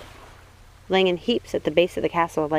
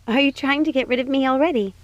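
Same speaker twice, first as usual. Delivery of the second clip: higher voice, playful mock hurt; 7 semitones higher than usual. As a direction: tease her cousin as Snow.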